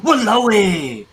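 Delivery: slow, frustrated Walao eh; frustrated Singlish. Shown as a demonstration. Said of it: "You can express, um, frustration".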